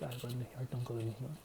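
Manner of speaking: a hushed, lightly voiced uncle; uncle's quiet boast. Uncle claims he runs city.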